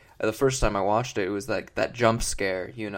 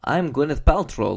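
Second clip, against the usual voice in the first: mock thick Transylvanian accent, a bit louder; hammed-up Transylvanian introduction; Gwyneth Paltrow; 3 semitones higher, 5 dB louder than usual. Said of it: "she actually has a very thick accent".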